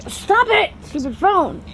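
cursing her phone mid-recording; annoyed outburst. Real, not acted. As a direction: vent at a misbehaving phone.

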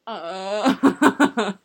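loud helpless laughter; laughing hard. Real, not acted. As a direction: crack up loudly. Bursts out laughing.

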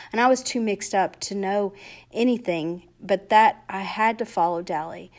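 ordinary delivery, nothing marked

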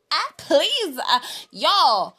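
loud, exasperated protest. Real, not acted. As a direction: push back hard, loud and fed up. Indignant exclamation.

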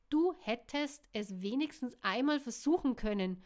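a sharp, loud reproachful demand; annoyed demand. A narrator's reading. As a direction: demand it of him, irritated. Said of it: "forderte sie ärgerlich".

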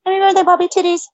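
high, sing-song birthday call; playful shoutout. Real, not acted. Wishing Bobby happy birthday, high and playful. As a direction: toss it out cheekily.

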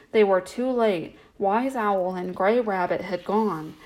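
usual speaking voice

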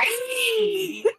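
high laugh. Real, not acted. Laughs at own voice.

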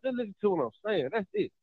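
ordinary talk

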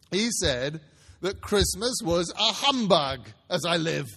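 loud laughing exclamation; cried out. A narrator's reading. Fred crying out to the party, loud and amused. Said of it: "cried Scrooge's nephew".

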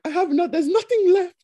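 high, loud mock wail; hammed-up tearful pleading; imitated crying politician. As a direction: plead it like a politician putting on tears. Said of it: "as he's crying and saying there's nothing".

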